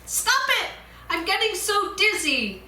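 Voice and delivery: dizzy panicked screaming plea; screamed. Acted. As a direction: scream as the wheel spins you. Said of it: "I screamed".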